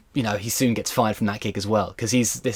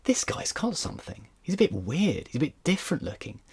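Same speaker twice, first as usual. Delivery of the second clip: soft, half-whispered musing; retired headmaster quietly sizing up the actor; murmured aside; 5 semitones higher, 7 dB quieter than usual. Retold or imitated. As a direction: mull it over under your breath. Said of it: "who looks at him and goes".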